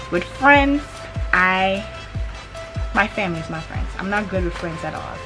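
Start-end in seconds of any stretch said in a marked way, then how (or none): none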